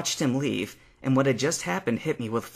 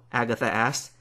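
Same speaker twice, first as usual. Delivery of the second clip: usual speaking voice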